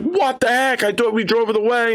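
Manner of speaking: high-pitched, startled protest; shocked player. Retold or imitated. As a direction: blurt it in high-pitched disbelief. Retold player shocked by the attack.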